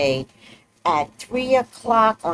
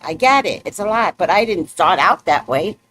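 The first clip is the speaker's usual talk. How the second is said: ordinary talk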